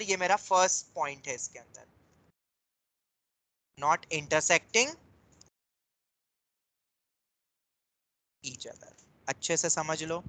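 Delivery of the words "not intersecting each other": slow, broken-up repeat for note-takers; dictating. Real, not acted. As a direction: draw the words out with a pause. Repeating the point for writing. (टुकड़ों में धीरे लिखवाते हुए)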